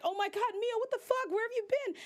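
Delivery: husband seeing his wife after two years; shocked husband. Retold or imitated. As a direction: call out to his lost wife in disbelief. Husband finds missing wife.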